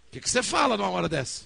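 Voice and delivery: loud, high outburst of helpless exasperation; retold exasperated yell; exasperated shout. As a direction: throw the question out at full volume. Acted retort to wife's prompting.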